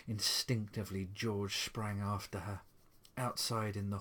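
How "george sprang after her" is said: usual speaking voice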